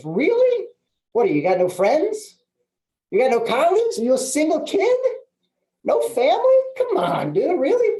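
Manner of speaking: teasing, voice leaping very high on the questions; playful mock disbelief. [name] teasing a client who offers no referrals, playfully incredulous. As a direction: rib a buddy with squeaky, exaggerated incredulity. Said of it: "if you deliver it like it's fun".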